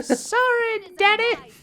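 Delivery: very high, hammed-up sorry; high mock apology. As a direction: squeak it like a guilty kid. Voicing character to dead father.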